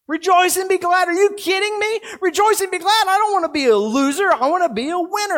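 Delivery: high, loud mock disbelief at the command; incredulous protest. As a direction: throw it back like a listener who can't believe it.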